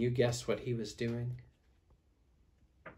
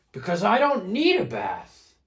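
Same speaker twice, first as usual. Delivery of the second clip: loud, higher-pitched protesting bear; Big Smelly Bear; 9 semitones higher, 12 dB louder than usual. Acted. Smelly bear refusing a bath, loud protest. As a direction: push back indignantly, loud and high. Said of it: "replied Big Smelly Bear".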